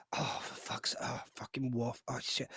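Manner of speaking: tired, low muttered swearing; very soft, low, worn-out put-on grumble; weary mock grumbling. Leader on his throne seeing Worf turn up, weary muttering. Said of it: "I'm so tired right now".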